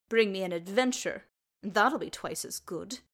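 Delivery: lightly voiced sulky adolescent; a touch of flippant pouting; sulky flippancy. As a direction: toss it off like a sulking teen. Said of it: "Gimli muttered, with the sulky flippancy".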